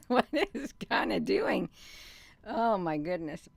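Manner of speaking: words squeezed through real laughter; giggling. Real, not acted. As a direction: talk while still laughing. Giggling over a hot flash.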